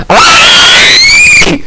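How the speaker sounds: sudden high scream; jump scare scream. Acted. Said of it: "He screamed in the Blart face".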